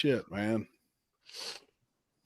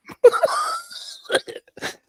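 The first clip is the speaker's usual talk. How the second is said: high laugh. Real, not acted. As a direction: burst out laughing. Big laugh at the joke.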